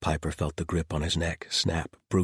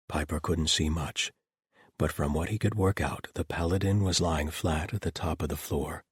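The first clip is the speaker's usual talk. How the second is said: usual speaking voice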